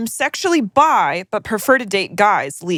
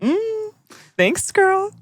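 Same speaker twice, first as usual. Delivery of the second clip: sassy thanks; 12 semitones higher, much slower than usual. Real, not acted. Reacting to listener's font comment.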